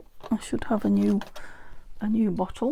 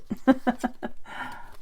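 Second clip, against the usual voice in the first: laugh; 5 semitones higher than usual. Real, not acted. Amused at scissors perking up.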